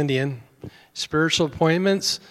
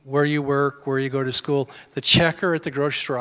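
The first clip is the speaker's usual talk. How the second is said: usual speaking voice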